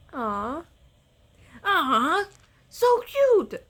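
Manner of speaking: high, loud squeal of cuteness; cooing. Real, not acted. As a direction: squeal over something adorable. Cooing over creatures.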